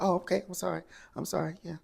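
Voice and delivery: high, small backpedalling sorry; mock sheepish apology. Shamea backpedals to Drew in a high mock apology.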